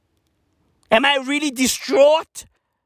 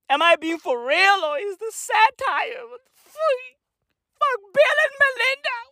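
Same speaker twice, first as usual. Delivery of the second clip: hammed-up hysteria; 13 semitones higher than usual. Mock breakdown continues.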